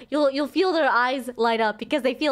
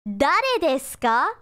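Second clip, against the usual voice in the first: slow, rising question read; slow model question; 2 semitones higher, slower than usual. Shown as a demonstration. Teacher models the question slowly for learners. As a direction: say it slowly with a lift at the end. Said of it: "or if you change kare with dare".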